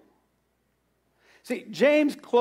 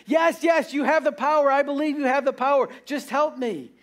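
high, hurried mock begging; acted urgent plea, easing at the end; desperate pleading; 5 semitones higher than usual. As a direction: play a dangling man frantic to agree. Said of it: "still hanging on the cliff".

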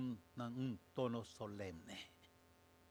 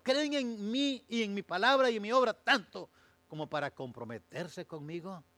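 fiery preaching; 9 semitones higher, 11 dB louder than usual. Acted. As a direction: hammer each question at the congregation. Preaching Jesus' challenge at full force.

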